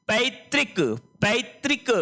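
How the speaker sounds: पैतृक read out as misspelt, with consonant tr and short i; wrong-form demo. Shown as a demonstration. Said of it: "इस तरह से नहीं लिखते हैं".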